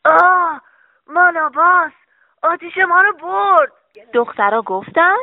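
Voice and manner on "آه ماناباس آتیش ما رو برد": loud, raised cry of alarm; high, loud old-man character shout; old man's shout. Acted. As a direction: cry out as if robbed. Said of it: "فریاد زد".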